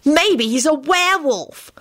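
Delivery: put-on high, slow girlish taunt; teasing. A narrator's reading. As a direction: draw out the jibe. Girl teases bully, others laugh.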